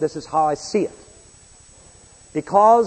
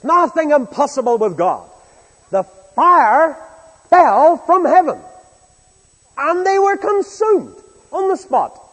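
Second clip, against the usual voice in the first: much higher and louder, slow ringing proclamation; preaching at full force; 11 semitones higher, 9 dB louder, slower than usual. Acted. Preacher proclaiming God's power to the congregation, fervent. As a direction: proclaim it to the rafters.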